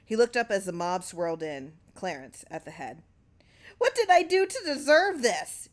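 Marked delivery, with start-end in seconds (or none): 3.8-5.7 high, loud anguished cry; cried out. A narrator's reading. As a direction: cry out in wronged disbelief. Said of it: "he cried".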